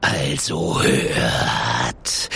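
very low, drawn-out hiss; hissing snake. A narrator's reading. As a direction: hiss it slowly like a serpent. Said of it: "zischt die Schlange".

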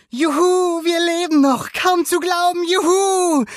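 very high, loud, overjoyed cheering; jubilant. A narrator's reading. Luca celebrates still being alive, jubilant. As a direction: cheer at the top of your voice. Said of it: "jubelte Luca".

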